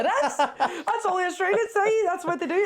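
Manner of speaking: high-pitched insisting through laughter; laughing protest. Real, not acted. Insisting to a doubting friend that it's true, laughing protest.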